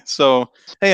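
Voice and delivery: ordinary talk, nothing marked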